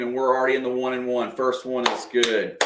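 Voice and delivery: ordinary delivery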